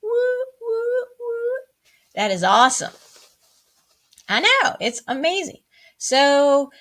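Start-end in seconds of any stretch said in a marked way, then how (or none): 0.0-1.6 high-pitched cheering woos; celebratory woo. Real, not acted. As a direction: cheer the class on with high whoops. Teacher cheering on the class.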